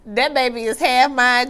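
normal talking voice

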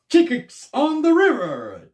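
hyped food host announcing the restaurant; Scott Eats voice. Retold or imitated. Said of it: "That's Scott's Eats".

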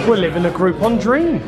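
ordinary delivery

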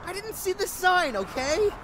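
high, loud defensive cry. Acted. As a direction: snap back defending yourself. Defensive under accusation.

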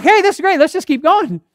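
high, loud cheer; retold excitement. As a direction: act out an enthusiastic group decision. Retold happy decision to continue.

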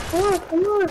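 normal talking voice

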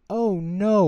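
a high, loud cry of dismay; oh no, wailed in alarm; wailing. Acted. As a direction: wail it out as the whale sees the danger. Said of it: "wailed Sherman".

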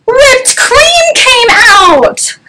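very high, slow drawn-out squeal; storyteller's hammed-up shock; astonished reveal. A narrator's reading. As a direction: shout it slowly in sky-high amazement.

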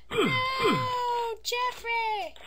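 very high, drawn-out mock wail; creepy high wail. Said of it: "That's probably really creepy".

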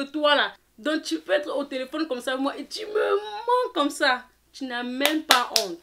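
indignant scolding. Retold or imitated. Narrator telling the friend she has no shame, indignant scolding. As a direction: scold her loudly, stretching the last words in outrage.

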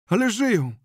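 high, loud frantic cry; desperate cry. Acted. Zenon pleading that they are still alive, high frantic cry. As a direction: insist they are alive, almost shouting.

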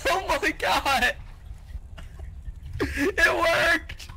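high, slow, laughing cheer; laughing excitedly. Real, not acted. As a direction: crack up with delight. Bomb succeeds, laughing.

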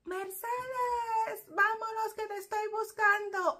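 very high, loud long call; imitating a mother yelling from the window for her kid; mother shouting from the window. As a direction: holler for her daughter down the street. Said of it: "de la ventana vocifera".

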